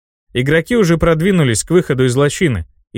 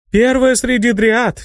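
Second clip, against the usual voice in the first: high, slow correction of her title; high dryad voice; 10 semitones higher, slower than usual. Acted. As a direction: lift it high and slow, modestly. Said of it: "возразила она".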